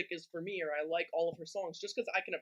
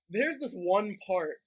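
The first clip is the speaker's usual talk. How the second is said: ordinary delivery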